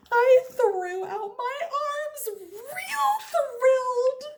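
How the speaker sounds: high, slow excited cry; thrilled. A narrator's reading. Junie B bursting with excitement at her desk. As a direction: burst with joy.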